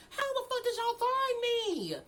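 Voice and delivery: high, laughing disbelief; incredulous. Retold or imitated. Asking parents how they found her, high laughing disbelief. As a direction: squeak out your shock, half laughing.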